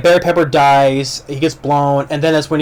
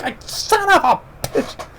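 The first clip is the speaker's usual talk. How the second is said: much higher, slow, half-laughing, half-crying groan of a curse; wounded curse; 3 dB quieter, slower than usual. Retold or imitated. Shot soldier curses his wound, high strained slow groan. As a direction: spit it out like you just got shot. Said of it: "he's like".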